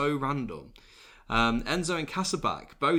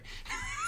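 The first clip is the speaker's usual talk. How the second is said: high laugh; 8 dB quieter than usual. Real, not acted. Laugh at burglary punchline.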